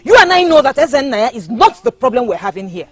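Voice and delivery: loud, pressing her point. Acted. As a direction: push back hard at him, loud. Lovers quarrel over proposal.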